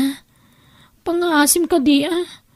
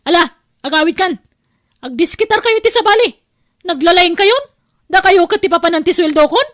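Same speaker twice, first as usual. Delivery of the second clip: high, loud, fast scolding tirade; angry scolding; 5 semitones higher, 4 dB louder than usual. Acted. Aunt refusing the niece a loan, shouting angrily. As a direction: drive the girl out of the house.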